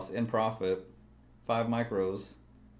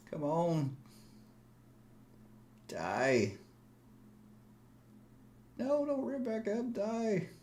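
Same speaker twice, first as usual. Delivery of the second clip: high, desperate urging; pleading with the chart; 12 semitones higher, 3 dB quieter, slower than usual. Real, not acted. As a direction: beg the price to fall.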